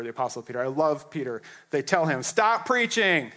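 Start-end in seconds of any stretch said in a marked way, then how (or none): 2.2-3.3 slow, higher command; stern order. Acted. Council ordering Peter to stop, slow and stern. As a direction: lay down the order slowly.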